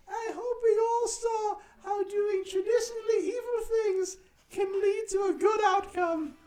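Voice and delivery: Tiny Tim shouts the lesson to everyone; shouted child moral. Acted. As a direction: belt the moral like an overjoyed little boy.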